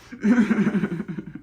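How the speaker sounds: short chuckling laugh. Real, not acted. After retelling ancestors' teasing, amused laugh. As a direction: laugh in quick bursts.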